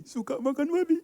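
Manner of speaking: high, loud, stretched-out cry; comic exclamation. Acted. Speaker joking to the audience about his number plate, comic exclamation. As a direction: belt it out high and drag the ending.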